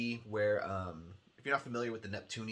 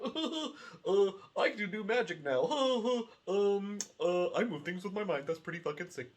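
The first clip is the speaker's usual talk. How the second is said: higher, giggly mock of a cheesy game heroine; 10 semitones higher than usual. Sending up the game character's lines to viewers, mocking impression. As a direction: parody the dialogue, laughing through it. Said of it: "and she's like".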